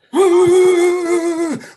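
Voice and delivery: long, high, shuddering wail; loud mock shivering. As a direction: imitate violent shivering at full voice. Imitates the class's uncontrollable shaking.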